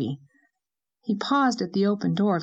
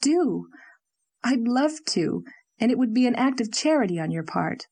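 higher-pitched, eager acceptance; an eager exclamation, pitched up; exclaiming eagerly; 5 semitones higher than usual. A narrator's reading. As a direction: jump at the offer. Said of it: "exclaimed Leslie".

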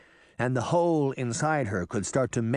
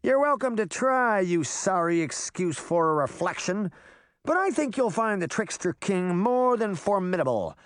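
put-on higher-pitched, boastful boy; cocky threat, voice pitched up; Puck, defiant trickster; 8 semitones higher than usual. A narrator's reading. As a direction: challenge a villain with swagger.

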